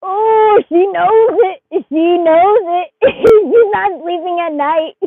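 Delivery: thrilled reveal, drawn out; excited. Real, not acted. Excited reaction to a card.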